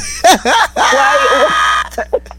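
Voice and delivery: shrieking burst of laughter. Real, not acted. Laughs at patois question.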